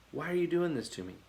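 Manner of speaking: lightly voiced, soft quick plea; a wounded animal asking why, softly; hint of a hurt donkey. Preacher lightly voices the donkey, soft hurt plea.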